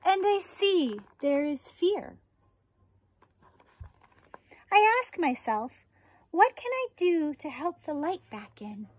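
slow, gentle storybook read; reading to children. Acted. Teacher reading a book on compassion to her fourth graders, gentle read-aloud. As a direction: read it tenderly to the class, pausing often.

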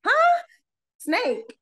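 high startled yelp then confused repeat; startled disbelief. Retold or imitated. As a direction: jolt up in shock, then check what you heard. Hearing a snake warning.

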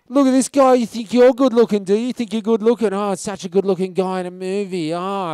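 loud, high, jeering taunts; mock insulting. Drunk guy mocking an actor's good looks, jeering imitation. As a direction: imitate a drunk heckler sneering at someone's looks. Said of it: "he started insulting us".

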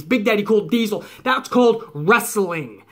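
usual speaking voice

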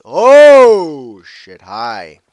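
shocked yelp at a creeper; startled yelp. Real, not acted. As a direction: jump out of your skin.

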